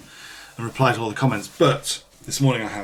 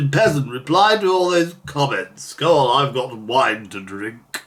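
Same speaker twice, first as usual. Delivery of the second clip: haughty lord; 3 semitones higher, 6 dB louder than usual. Pretend. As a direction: play the lazy aristocrat barking orders at a peasant. Ordering an imaginary servant.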